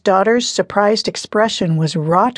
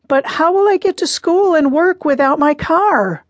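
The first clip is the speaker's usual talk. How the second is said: put-on whiny teen protest; 9 semitones higher than usual. Grounded daughter protests.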